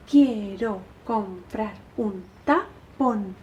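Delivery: slow, measured syllable count; recitado en marcha. Shown as a demonstration. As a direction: beat out each syllable slowly. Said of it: "como si estuvierais haciendo marcha". (recitando despacio, marcando cada sílaba)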